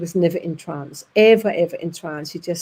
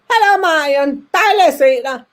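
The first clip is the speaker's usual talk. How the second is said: put-on higher, louder old man's voice in Danish; father greeting in Danish; 6 semitones higher, 6 dB louder than usual. Dead father greeting his daughter Marion, in Danish. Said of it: "in Danish he went".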